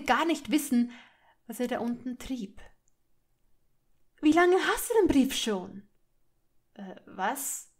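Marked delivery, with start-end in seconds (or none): 4.2-5.9 higher, louder, accusing question; angry demand. A narrator's reading. Hermine confronting Malfoy over the letter, angry demand. 6.7-7.7 softer, hesitant question; taken aback, stumble into the reply; caught off guard. A narrator's reading. Malfoy suddenly confronted by Hermine, caught off guard. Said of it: "fragte er überrumpelt".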